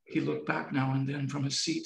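ordinary delivery

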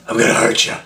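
sudden rasping menacing growl; Crack Fox deep alter ego. Retold or imitated. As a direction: switch into a crazed dark alter ego. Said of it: "crazy, deep alter ego".

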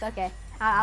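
ordinary talk, nothing marked

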